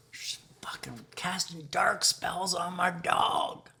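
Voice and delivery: secretive whisper. Real, not acted. Unvoiced whisper.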